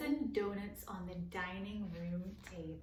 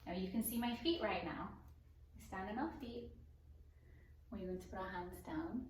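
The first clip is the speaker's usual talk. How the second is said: ordinary talk, nothing marked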